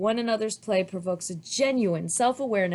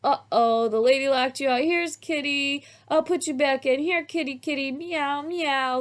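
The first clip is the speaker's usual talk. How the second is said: put-on child voice; 7 semitones higher than usual. A narrator's reading. Child plays with kitty.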